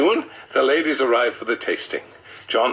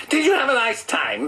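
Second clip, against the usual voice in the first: wife's voice; 13 semitones higher than usual. Acted. Narrator voices wife Janet.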